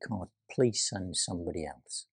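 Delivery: quiet plea. Acted. We pleading with God.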